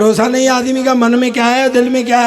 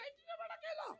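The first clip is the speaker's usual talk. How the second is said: high squeak of disbelief on "इतना बड़ा केला"; amazed; 33 dB quieter than usual. Acted. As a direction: jump way up in pitch, astonished foreigner. Englishman amazed at banana.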